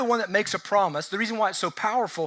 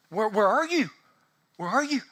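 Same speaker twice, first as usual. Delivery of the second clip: higher, anxious calling out, rising on the repeat; mock panicked calling; 4 semitones higher than usual. Believer feeling abandoned calls out to God, anxious and higher.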